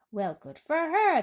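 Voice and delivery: high, loud, slow exclamation; cops crying out. A narrator's reading. Cops cry out approval of the silkworm, high and loud. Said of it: "the cops cried out".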